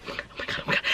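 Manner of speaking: panicked whisper; frightened whispered oh my God. Retold or imitated. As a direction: breathe it out in terror, no voice. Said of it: "He's like".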